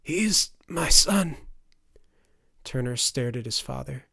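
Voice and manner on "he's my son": slow, high, strained croak. A narrator's reading. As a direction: croak it out on a long painful breath. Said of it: "croaking breath".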